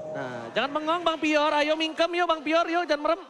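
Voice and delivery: ordinary delivery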